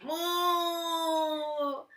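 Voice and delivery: lowing cow in pain; pained cow moo. Acted. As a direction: moo like a cow pricked by thorns. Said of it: "Moo Moo went".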